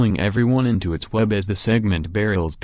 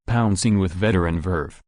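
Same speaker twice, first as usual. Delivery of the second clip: plain reading voice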